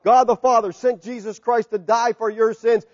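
ordinary delivery

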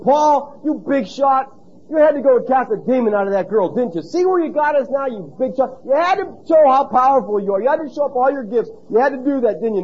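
high, loud accusing gripe at Paul; Silas complaining. Pretend. Silas griping at Paul in the jail, high and accusing. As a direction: blame him, sarcastic and bitter. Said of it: "What if Silas had said".

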